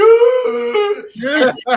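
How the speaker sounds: imitated cow-like bawling cry; loud high wail. Mother imitating her own wailing at her son's birth.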